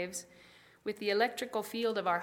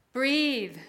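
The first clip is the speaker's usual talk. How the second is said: loud pleading call, pitched up; crying out; 4 semitones higher, 8 dB louder than usual. Pretend. Hearts crying out for breath, loud plea. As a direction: cry the word out like a plea. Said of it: "Our hearts cry out".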